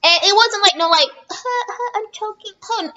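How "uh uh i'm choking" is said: mock weak choke. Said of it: "It was like all force".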